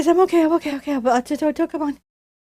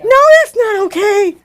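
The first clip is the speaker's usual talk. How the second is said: higher, louder scared protest; freaked out; 4 semitones higher, 5 dB louder than usual. Real, not acted. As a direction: refuse with real alarm. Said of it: "So freaked out".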